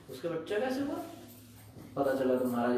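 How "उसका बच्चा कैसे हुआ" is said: high, softer questioning voice; mock astonishment, pitch climbing through the question; acted disbelief. Queen reacts to news of her maid's child, acted disbelief.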